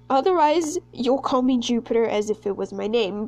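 ordinary talk

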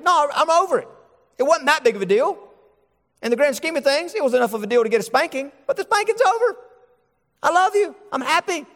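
high, bright-voiced reassurance to the child; forgiving parent. Parent reassuring the hurt child, high and tender. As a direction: pour out easy forgiveness, pitched well up. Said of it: "the parent ... is thinking".

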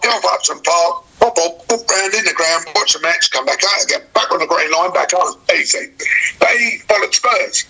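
normal talking voice

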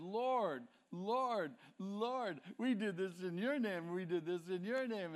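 acted-out protest to the Lord; mock desperate plea. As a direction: plead your case insistently. Acted plea of rejected religious people.

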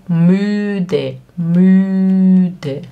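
"Müde" with the ü stretched very long; pronunciation drill. Shown as a demonstration. Teacher getting learners to speak along, drawn-out level "Müde". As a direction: hold the ü of "Müde" steady before the short ending. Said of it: "Tenta falar comigo".

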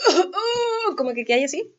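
high, strained gasping for air; winded gasps after falling flat on the back; imitated breathless gasps. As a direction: gasp like you're winded from a fall. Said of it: "cuando te caís de espalda y como que no podís respirar".

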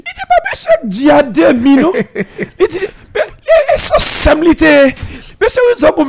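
high, broken voice on the edge of tears; imitating crying. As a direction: play the former student choking up with emotion. Former student crying, retold. (voix brisée d'émotion, très aiguë)

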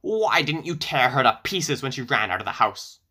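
plain reading voice

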